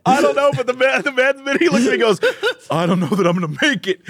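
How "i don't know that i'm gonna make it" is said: imitated hopeless confession; complete loss. Man looks at neighbor in elevator, mock defeat. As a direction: give up on life as you say it. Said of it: "He said it with such".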